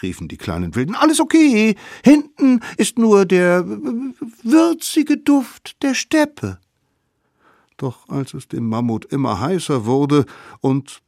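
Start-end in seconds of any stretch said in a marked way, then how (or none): 1.0-6.6 put-on much higher, cheeky kid voice; sly children plotting a trick; high scheming kid voice; little wild kids. Kids lying.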